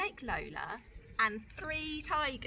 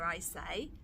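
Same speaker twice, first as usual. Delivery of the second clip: ordinary delivery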